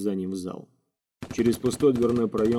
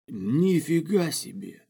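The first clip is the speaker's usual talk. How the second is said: slow, higher, impressed exclamation; admiring amazement; 7 semitones higher, much slower than usual. A narrator's reading. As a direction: draw it out in admiration. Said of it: "восхитился Паля". (восхищённо, нараспев)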